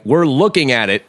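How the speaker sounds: insistent retort. Retold or imitated. Workers to their boss in denial, mock insistent. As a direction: imitate a worker pressing the obvious.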